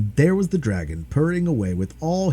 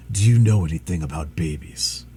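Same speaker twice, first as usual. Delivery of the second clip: tired dragon; 7 semitones lower than usual. Acted. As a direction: sigh it out, exhausted. Dragon asks the woman.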